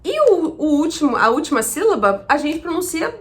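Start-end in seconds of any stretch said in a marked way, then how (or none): none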